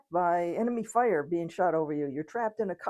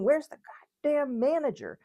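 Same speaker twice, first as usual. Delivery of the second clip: high, loud angry outburst, acted; mock scream; 8 semitones higher, 4 dB louder than usual. Customer stuck in the checkout line, mock screaming. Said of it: "You could start screaming".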